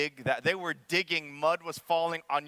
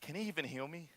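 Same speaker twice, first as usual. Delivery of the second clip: doubting patient; 2 semitones lower, 12 dB quieter than usual. Acted. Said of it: "Imagine the man first on the bed going".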